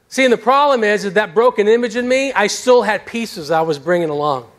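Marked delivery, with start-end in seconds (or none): none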